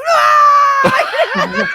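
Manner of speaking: high loud laughing scream. Retold or imitated. Stunt crew reliving the falling car, laughing scream.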